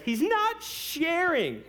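high, loud, drawn-out complaint; slow comic high-pitched protest; whining tattletale heckler. Acted. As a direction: wail it like a tattling child. Imagined heckler interrupts serious sermon.

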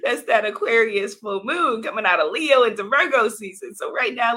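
normal talking voice

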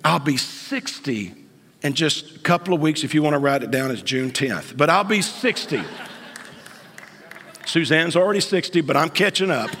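ordinary talk, nothing marked

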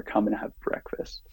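whispered, unvoiced invitation; soft whisper. Jesus inviting Peter to breakfast, in a soft whisper. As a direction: breathe it like a quiet, gentle invitation. Jesus's first words, gently quoted.

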